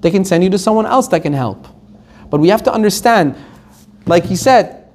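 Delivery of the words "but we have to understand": usual speaking voice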